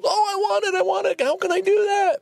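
put-on very high, pleading kid voices; high-pitched mock whining; eager kids begging. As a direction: mimic students begging for it. Students begging, quoted excitedly.

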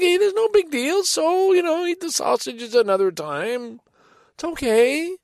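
high, careful reassurance held together; forced calm. Retold or imitated. Husband soothing his wife about supper plans, forced high calm. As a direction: sound patient though strained. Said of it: "trying to be calm".

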